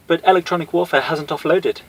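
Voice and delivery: put-on high British accent; reply crisply in British English. Cammy replying to Guile, in a high British accent. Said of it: "Cammy replied in a British accent".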